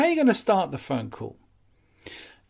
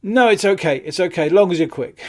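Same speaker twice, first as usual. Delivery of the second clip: hurried customer; 5 semitones higher, 6 dB louder than usual. Retold or imitated. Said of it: "they're probably not gonna sound overly friendly".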